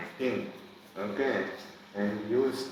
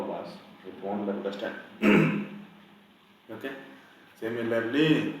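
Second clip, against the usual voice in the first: usual speaking voice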